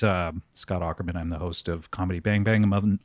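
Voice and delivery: ordinary talk, nothing marked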